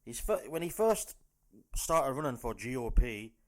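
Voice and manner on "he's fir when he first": usual speaking voice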